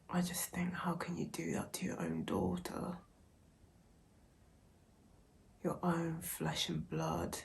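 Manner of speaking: soft, hushed voice close to tears; barely holding it together, whispering her disbelief; emotional, near tears. Real, not acted. Vlogger reacting to the sad book passage, hushed and tearful. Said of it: "Oh, wow, I'm so emotional".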